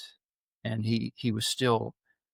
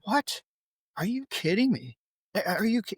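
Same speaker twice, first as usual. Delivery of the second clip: high-pitched retold disbelief; incredulous; 8 semitones higher than usual. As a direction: react as if stunned by the news. Retold disbelief at friend's news.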